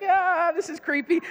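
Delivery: celebrity's reaction. Retold or imitated. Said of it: "he's like".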